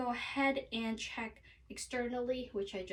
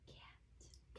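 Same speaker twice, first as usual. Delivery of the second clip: breathy whispered word for a hearing test; 22 dB quieter than usual. Shown as a demonstration. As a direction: whisper it softly from behind. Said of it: "do the whisper test".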